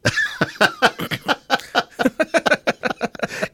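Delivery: long, high-pitched run of laughter; real, sustained high laughing fit. Real, not acted. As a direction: laugh hard and long at the joke. Laughing at the caller's joke.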